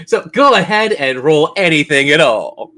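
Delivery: ordinary delivery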